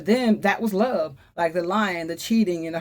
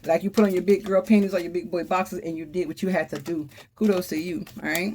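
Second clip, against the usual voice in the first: ordinary talk, nothing marked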